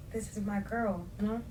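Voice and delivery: ordinary talk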